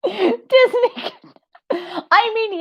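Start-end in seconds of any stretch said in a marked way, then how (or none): 0.0-1.1 a high, slowed mock of the comment; high squeaky echo. Reading back a viewer's line, high and squeaky.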